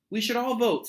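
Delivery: put-on high, loud eager kid voice; LaToya's line. Narrator voicing LaToya urging friends, eager high kid voice. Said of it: "said LaToya".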